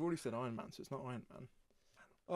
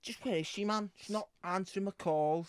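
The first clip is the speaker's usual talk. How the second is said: put-on slightly higher Scouse accent; Batman; 4 semitones higher than usual. Batman worrying about unanswered calls, Scouse accent. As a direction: complain in broad Scouse. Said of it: "Scouse Batman".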